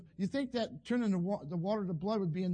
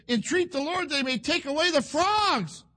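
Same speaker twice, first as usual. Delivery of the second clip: raised-pitch dramatic scripture quote; Pharaoh's plea; 8 semitones higher, 6 dB louder than usual. Acted. Preacher reads Pharaoh begging relief from frogs, high and emphatic. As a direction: plead it out, swelling on the last word.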